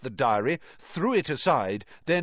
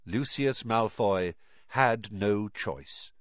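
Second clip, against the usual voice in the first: plain reading voice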